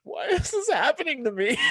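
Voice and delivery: wailing on the floor in pain; mock self-pity. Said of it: "I'm just like".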